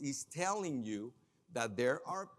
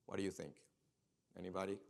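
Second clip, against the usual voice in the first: normal talking voice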